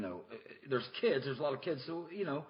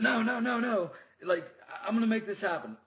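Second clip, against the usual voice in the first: higher, louder pleading client voice; 9 semitones higher, 4 dB louder than usual. Retold or imitated. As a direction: beg like a desperate customer who won't quit. Client begs to stay.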